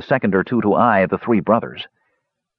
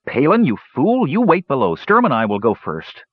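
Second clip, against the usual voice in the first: higher-pitched, scolding command; bossy order; 6 semitones higher than usual. A narrator's reading. Older brother ordering the younger to wait, bossy.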